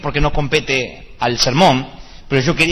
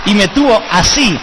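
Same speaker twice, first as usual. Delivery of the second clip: ordinary delivery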